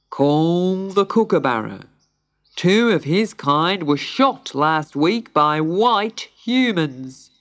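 slow, ponderous pelican pronouncement; solemn pelican judge. A narrator's reading. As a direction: draw out each word with great gravity.

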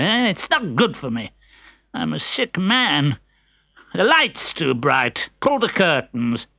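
put-on whiny, complaining old miser; grumpy old man. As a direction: grumble like a sick old man. Pantalone complaining.

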